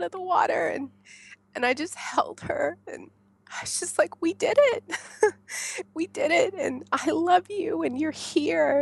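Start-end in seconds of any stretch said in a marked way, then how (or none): 4.2-8.8 much higher, joyful cry; overjoyed at holding her baby; laughing through the joy; elated. Retold or imitated.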